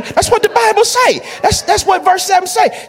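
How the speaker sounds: shouted proof from the verse; preaching shout. Real, not acted. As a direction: drive it home to the church.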